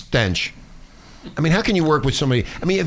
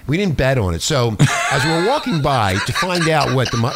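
normal talking voice

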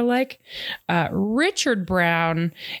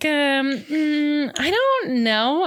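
high, drawn-out reluctant hedging; mock hesitant; 8 semitones higher, much slower than usual. Jamie dodging the gang's invitation, mock hesitant. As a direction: stall in a high, noncommittal voice.

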